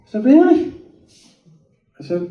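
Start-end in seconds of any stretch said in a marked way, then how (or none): 0.2-0.7 high rising question of acted amazement; surprised. Speaker hears God spoke to Alan, high surprised question. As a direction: squeak up in disbelief.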